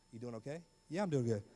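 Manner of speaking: low, soft small talk; mock a dull call in a low murmur; awkward phone chat. Teens still on the phone twenty minutes later, awkward. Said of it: "even if the conversation went like this".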